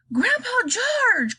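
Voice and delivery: put-on a boy calling out high and slow to his grandpa; crying out. A narrator's reading. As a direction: call him back in alarm. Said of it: "cried Charlie".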